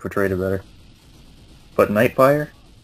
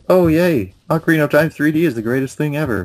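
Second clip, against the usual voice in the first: higher, louder mock-excited fan; mock gushing; 6 semitones higher, 3 dB louder than usual. A player parroting everyone's praise of the remake, mock gushing. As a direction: gush like everyone else, sarcastically. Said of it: "Everyone's like".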